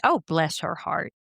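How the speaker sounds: gently sincere sympathy; quick, understated heartfelt sympathy. Shown as a demonstration. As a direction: say it as if you mean it. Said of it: "It could be sincere".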